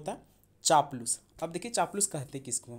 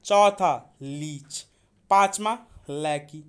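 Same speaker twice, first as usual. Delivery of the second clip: counting through the words slowly and louder; slow counting drill; 6 dB louder, slower than usual. Shown as a demonstration. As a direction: count off each word slowly and loudly. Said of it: "चलिए एक बार counting करते हैं।".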